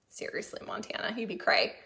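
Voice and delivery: a breathless whispered seriously; whispered aside. Real, not acted. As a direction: whisper it like a secret. Aside about neighbor's snow claim.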